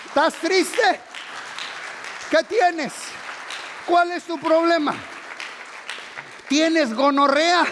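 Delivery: loud, high-pitched sermon questions; fiery preaching. Acted. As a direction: challenge the crowd at full force. Preaching at full force.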